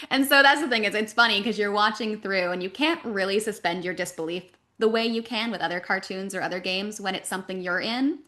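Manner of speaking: ordinary delivery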